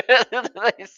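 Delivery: high-pitched word breaking out of laughter; laughing. Real, not acted. Host cracking up over the deported monkey, laughing. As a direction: try to start talking mid-laugh.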